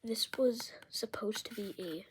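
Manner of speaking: hushed relaxing whisper for a sound video; ASMR whisper. Real, not acted. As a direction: whisper close to the mic as if lulling them to sleep. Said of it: "Why am I, why am I whispering?".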